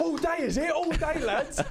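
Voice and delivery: very high-pitched mock indignation; annoyed opponent. Centre mid running up to his taunter, hammed-up annoyance. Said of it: "He was so annoyed by this point".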